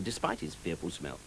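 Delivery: ordinary delivery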